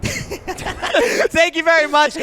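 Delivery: laughing through the thanks. Real, not acted. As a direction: chuckle out the thanks. Host wraps up laughing.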